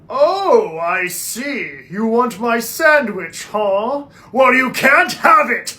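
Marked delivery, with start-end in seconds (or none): none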